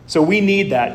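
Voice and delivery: ordinary delivery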